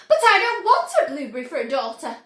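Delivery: loud, fast yell. A narrator's reading. Mother yelling at Wonka over her daughter, loud and fast. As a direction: rattle it off in a fury. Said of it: "yelled Missus Beauregard".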